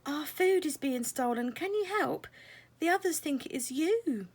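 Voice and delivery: high, urgent pleading voice; urgent fairy. Acted. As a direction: ask for help in a hurry. Fairy asks for help.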